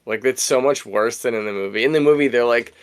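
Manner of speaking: normal talking voice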